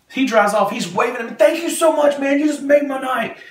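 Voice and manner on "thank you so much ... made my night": high, loud, elated imitation of a departing driver; yelling out the window. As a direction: shout your thanks from a moving car, high. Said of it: "he's yelling out the window".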